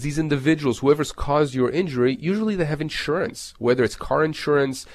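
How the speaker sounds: ordinary talk, nothing marked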